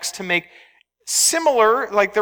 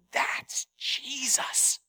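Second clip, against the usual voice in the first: hushed, drawn-out whisper; awed little boy; 6 semitones higher, 9 dB quieter, much slower than usual. Retold or imitated. Little boy to his father during the preaching, awed whisper. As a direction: whisper it slowly, like an awed child. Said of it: "the little boy ... said to his father".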